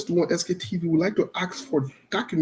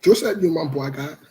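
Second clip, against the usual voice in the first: ordinary talk, nothing marked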